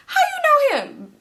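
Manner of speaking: mock-surprised friend on the phone. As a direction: sound caught off guard.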